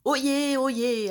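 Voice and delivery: loud proclaiming town crier call. Acted. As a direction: cry it out like a medieval herald. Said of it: "Oyez, ça ne fait pas un peu vieillot?".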